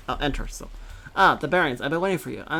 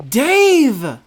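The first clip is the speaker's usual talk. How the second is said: loud, high shout of a name; calling out; 11 semitones higher, 14 dB louder than usual. Acted. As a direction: call him from across the deck.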